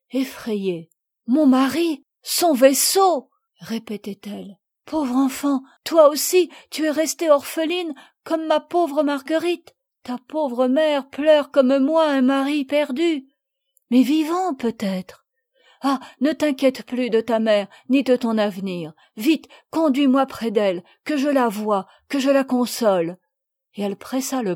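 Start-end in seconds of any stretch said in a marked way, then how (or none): 1.3-3.3 loud, high, slow cry of shock. Acted. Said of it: "poussa un cri". 4.9-13.3 high, loud, slowing voice full of grief; moved pity. Acted. Widow's grief over orphaned girl. 13.9-23.2 urgent hope. Acted. Lady urgently promises help.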